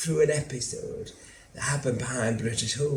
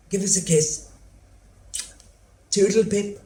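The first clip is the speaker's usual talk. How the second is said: higher, louder chirpy farewell; breezy goodbye; 8 semitones higher, 6 dB louder than usual. Acted. Mother leaving her son for a date, chirpy and high. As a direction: sing it out as a bright mock-posh goodbye.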